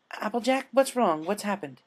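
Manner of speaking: high, alarmed question; Twilight worried. Acted. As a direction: ask in sudden worry. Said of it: "turned to her friends".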